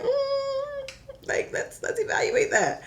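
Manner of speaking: high, hesitant, tiptoeing voice; imitating cautious friends. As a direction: mimic careful friends stalling, pitched high. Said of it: "all my friends were like".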